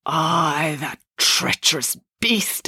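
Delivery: slow, drawn-out ghostly accusation; stretched, bitter ghost voice; accusing ghost. A narrator's reading. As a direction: spit the accusation out ponderously. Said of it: "accused the ghost".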